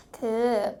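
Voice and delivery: ordinary delivery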